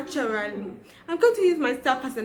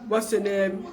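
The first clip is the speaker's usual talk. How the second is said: lower, put-on adult voice; changed, put-on voice; 4 semitones lower than usual. Shown as a demonstration. Speaker shows how she changed her childish voice, low and put-on. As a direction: drop the pitch into an assumed grown-up voice. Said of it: "I changed my voice in this way".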